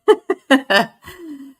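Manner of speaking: breathless laugh. Real, not acted. Breathless laugh after greetings.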